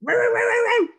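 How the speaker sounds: loud, high barking imitation; dog barking loudly. Sheepdog Meg barking loudly at the hedge. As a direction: bark hard at the hedge. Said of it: "growling and barking".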